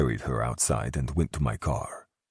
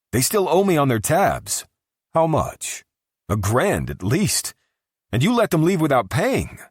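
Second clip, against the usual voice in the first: high, loud complaining voice; whiny bartender; 13 semitones higher, 6 dB louder than usual. A narrator's reading. Bartender whining, high and loud. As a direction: bartender complains to Luca about tabs.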